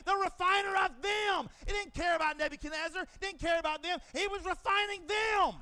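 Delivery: loud, high preaching shout; fiery preacher crescendo; preaching at full force. Real, not acted. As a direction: drive it home from the pulpit. Preaching climax.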